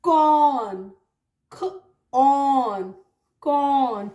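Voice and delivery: "कॉर्न" with aspirated k, long aw, no r. Shown as a demonstration. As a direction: break "कॉर्न" into "ख" and "ऑन". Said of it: "तो हम क्या पढ़ेंगे इसको".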